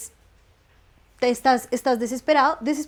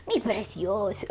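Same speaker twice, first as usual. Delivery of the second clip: mock Gollum; 4 dB quieter, slower than usual. Clinging to emotion like Gollum.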